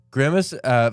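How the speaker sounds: ordinary talk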